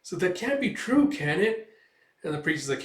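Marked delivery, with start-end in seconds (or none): 0.0-1.7 high, worried little voice; ask, hoping it isn't true; disbelieving girl. Retold or imitated. Girl reacts to talk of spirits.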